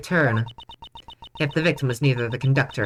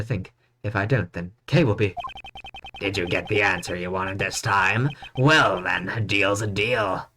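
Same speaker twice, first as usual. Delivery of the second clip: lower, slow smooth menacing voice; soothing villain voice; 5 semitones lower, slower than usual. Acted. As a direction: purr it low and slow, mock-soothing. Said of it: "I have a very soothing voice".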